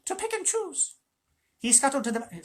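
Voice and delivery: high, sly jackal voice; a squeaky scavenger fawning and sneering. Acted. Tabaki voice held.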